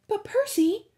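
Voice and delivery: put-on high, urgent girl's voice; a sudden high plea; Annabeth's alarmed cry. A narrator's reading. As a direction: cry out his name in alarm. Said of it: "Annabeth said".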